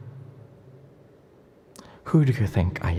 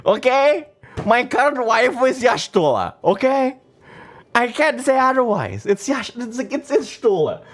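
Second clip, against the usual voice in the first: high cutesy anime voice. Acted. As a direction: gush about your favourite like an anime girl.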